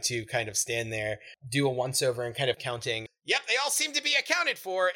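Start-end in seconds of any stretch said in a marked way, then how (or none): 3.3-4.9 high, chirpy gnome voice; high-pitched, pleased little gnome; satisfied gnome. Acted. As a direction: report the count in a high pitch. Plays the gnome owner.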